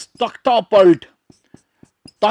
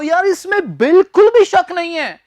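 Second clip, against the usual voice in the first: higher and louder, insisting yaar; emphatic casual remark; 8 semitones higher, 5 dB louder than usual. Acted. Teacher acting out a friend insisting there is no doubt.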